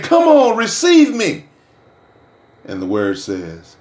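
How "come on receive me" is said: pleading voice of Jesus. Retold or imitated. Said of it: "He's saying".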